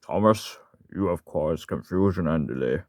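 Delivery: slow, deepened scolding controller voice; put-on voice of a stern railway boss; Sir Topham Hatt. Shown as a demonstration. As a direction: pronounce it slowly, like a boss reprimanding an engine. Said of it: "try to do that for Sir Topham Hatt".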